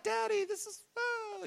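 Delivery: very high, thin kiddie voice; imitated frightened child. Lazarus' kids seeing daddy alive, imitated child voice. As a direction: squeak it like an overwhelmed child.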